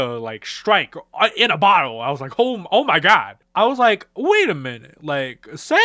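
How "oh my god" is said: normal talking voice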